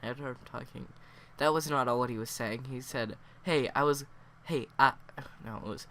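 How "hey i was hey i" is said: loud halting stammer; stuttering. Pretend. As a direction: trip over the start, loudly. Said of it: "I can't do the stuttering thing".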